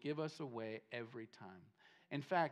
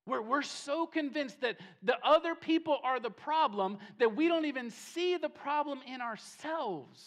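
high, loud preacher's voice; impassioned preaching; 8 semitones higher, 7 dB louder than usual. Real, not acted. Preacher telling people they miss their own problem, impassioned. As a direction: drive the point home forcefully. Preacher presses his point.

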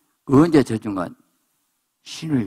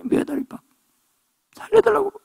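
frantic pleading. Acted. Desperate pleading described.

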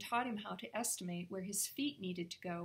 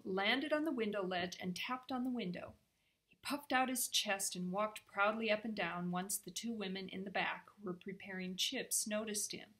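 usual speaking voice

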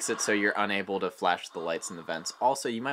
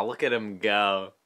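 ordinary talk, nothing marked

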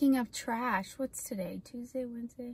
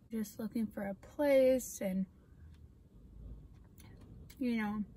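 talking while chewing; speaking right after a spoonful; mouth full of food. Real, not acted. Seen 0: spoon goes into mouth ~1.3–1.8s, then she keeps talking.

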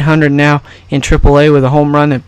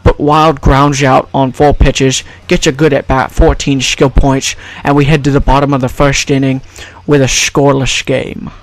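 Scottish film star calling play-by-play; slushy sh for every s; Sean Connery. Acted. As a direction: commentate in a put-on Scottish burr. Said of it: "brought to you by Sean Connery".

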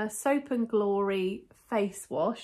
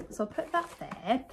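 usual speaking voice

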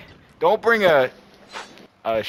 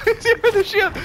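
laughing shout. Real, not acted. Repeats the warning laughing.

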